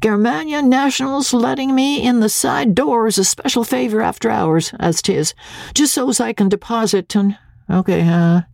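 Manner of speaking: high-pitched preoccupied father; half talk, half count, mind on the money; distracted high-voiced reply; absent muttering. A narrator's reading. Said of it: "Andy muttered absently".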